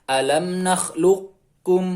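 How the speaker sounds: "nakhluqkum" chanted slowly, heavy q running into k. Shown as a demonstration. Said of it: "tetapi istilahnya masih tampak".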